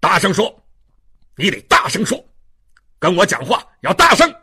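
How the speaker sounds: loud, imperious shouting; loud bullying demand. Acted. Inspector ordering the prisoner to speak up, loud. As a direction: browbeat him at full volume.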